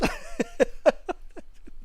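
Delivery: high breathy laugh of disbelief; airy stunned chuckle; incredulous laugh. Real, not acted. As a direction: laugh in shock at it. Disbelieving laugh at racist remark.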